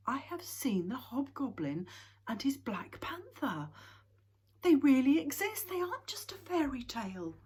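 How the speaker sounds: high, loud, thrilled outburst; excited. A narrator's reading. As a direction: burst out with wonder and joy. Snufkin thrilled at hobgoblin.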